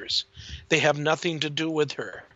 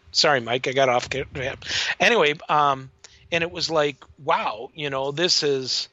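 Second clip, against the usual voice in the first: ordinary talk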